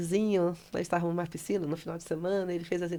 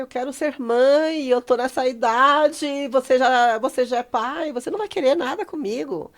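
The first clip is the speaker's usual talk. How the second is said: hammed-up wail, louder and higher; acted desperate confession; 6 semitones higher, 8 dB louder than usual. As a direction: pour it out as a dramatic plea. Acted pleading confession to partner.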